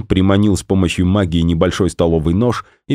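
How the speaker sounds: ordinary delivery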